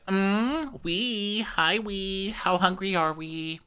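mock chirpy stewardess, high and quick; imitating a sugary hostess voice up high; sing-song high imitation of the chief stew greeting guests.

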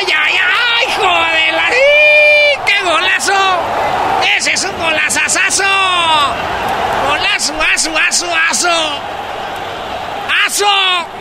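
shouted goal celebration; breakneck goal commentary. Acted. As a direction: scream the goal.

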